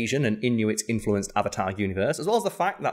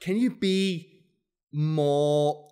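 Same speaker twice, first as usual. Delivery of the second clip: slow, halting, higher-pitched awkward request; 5 semitones higher, much slower than usual. Retold or imitated. Casting team fumbling a request to an actor, awkward. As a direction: tiptoe awkwardly through the question, drawing it out. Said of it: "they were really awkwardly like".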